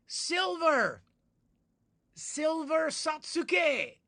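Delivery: high, loud, stretched-out greeting call; excited shout-out. Real, not acted. Greeting a fan in chat, excited high call. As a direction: call the name out with delight.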